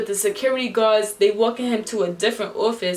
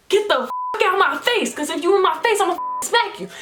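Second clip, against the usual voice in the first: higher-pitched, heated retold warning; angry retort; 9 semitones higher than usual. Telling a guy to get out of her face, angry. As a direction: back him off with a raised pitch.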